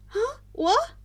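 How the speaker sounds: high, sudden confused yelp; startled. A narrator's reading. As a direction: jolt out of the daze. Snapped out of a daze.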